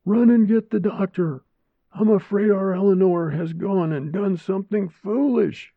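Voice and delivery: put-on high, loud, frightened elderly voice; urgent and scared, sending for help; panicked old woman. Old woman panics, sends for doctor.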